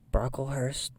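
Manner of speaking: ordinary delivery, nothing marked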